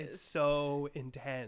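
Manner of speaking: slow mock awe. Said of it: "imagining them being like".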